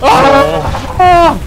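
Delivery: high, loud scream of surprise; startled yell. Real, not acted. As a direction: scream as you get hit. Startled yells at hit.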